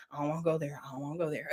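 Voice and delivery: low, soft, holding back; hushed mutter. Retold or imitated. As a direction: keep it down under your breath. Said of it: "you're like".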